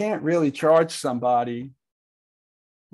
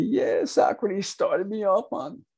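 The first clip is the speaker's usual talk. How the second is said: twitchy slurred sniffing addict; 6 semitones higher than usual. Acted. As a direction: play a strung-out junkie testifying. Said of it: "Johnny gets up there scratching like a junkie".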